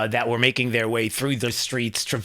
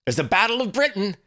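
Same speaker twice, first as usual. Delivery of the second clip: imitating rally speaker; 8 semitones higher than usual. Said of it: "He said".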